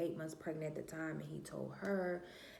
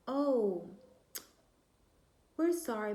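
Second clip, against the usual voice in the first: loud, high imitated apology; apologetic clinic caller; 6 semitones higher, 6 dB louder than usual. Clinic calls back about mixed-up results, high loud imitated apology.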